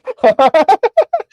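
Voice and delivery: high, loud burst of laughter. Real, not acted. Podcaster losing it mid-announcement, loud high laughter. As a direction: crack up helplessly. Cracks up at own remark.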